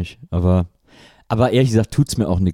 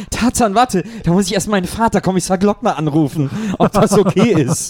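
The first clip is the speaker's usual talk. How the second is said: put-on laughing girlish voice; 6 semitones higher than usual. Voicing Gabi, laughing.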